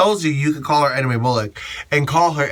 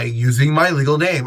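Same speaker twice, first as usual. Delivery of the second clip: normal talking voice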